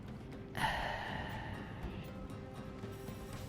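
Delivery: quiet, drawn-out exhale; long sigh. Real, not acted. Player unsure about flanking, long quiet sigh. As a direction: sigh slowly while weighing it up. Weighing a risky flanking move.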